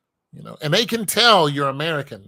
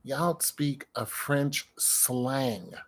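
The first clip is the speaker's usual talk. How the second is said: slow, spelled out; 7 dB quieter, much slower than usual. Real, not acted. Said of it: "Do you understand what that means?".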